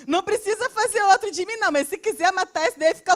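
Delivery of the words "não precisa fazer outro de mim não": said through a laugh, higher. Real, not acted. Joking with the church, laughing. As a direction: let the laugh carry the words. Laughs at his own joke.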